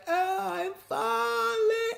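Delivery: high, drawn-out hammed-up wail; mock falling cry. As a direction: stretch it out like a slow-motion fake fall. Mock dramatic retelling of a fall.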